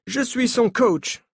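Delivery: put-on high, slow French with an American accent; the coach introduces himself in American-accented French. A narrator's reading. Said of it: "dit-il avec un accent américain".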